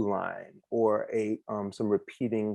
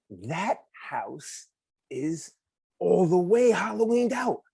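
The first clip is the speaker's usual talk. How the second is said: excited; 7 semitones higher, slower than usual. Acted. Kid voiced in story.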